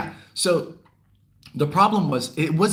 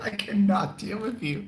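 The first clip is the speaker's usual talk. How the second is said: higher, giggling through it; laughing repeat; 9 semitones higher than usual. Retold or imitated. Repeating the reaction to the viewers, higher and laughing. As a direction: say it again, cracking up.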